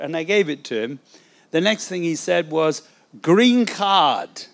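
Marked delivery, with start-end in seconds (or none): 3.2-4.5 loud, higher, badly faked French accent. Policeman demanding a second document, loud mock French accent. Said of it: "Not a very good French accent there".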